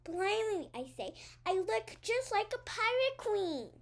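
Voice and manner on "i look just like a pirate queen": excited. Acted. Delighted at mirror.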